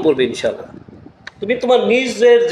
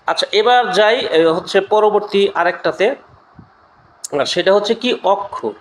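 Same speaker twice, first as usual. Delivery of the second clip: ordinary talk, nothing marked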